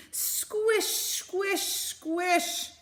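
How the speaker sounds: high, drawn-out squish noises; squishy sound word. Acted. Teacher voicing the mud under Mole's feet, playful and high.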